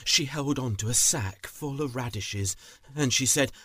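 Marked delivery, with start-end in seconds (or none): none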